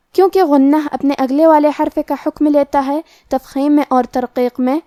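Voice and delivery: normal talking voice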